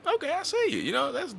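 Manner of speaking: high, impressed "okay, I see you"; impressed approval. Retold or imitated. To a friend who left and now travels, high impressed praise. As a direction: pitch jumps way up in mock admiration. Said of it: "I'm like".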